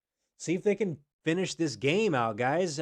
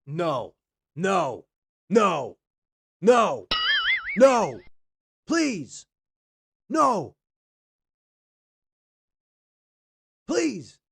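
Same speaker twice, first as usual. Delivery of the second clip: pleading no, rising higher and louder; begging it not to happen; anguished denial; 7 semitones higher, 10 dB louder, much slower than usual. Real, not acted. Watching a fumble, pleading.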